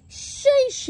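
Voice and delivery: sharp high, loud exhale of relief; startled sheesh. Real, not acted. Farmer startled by the dog he took for a coyote, sharp sheesh. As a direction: let the scare out in one breath.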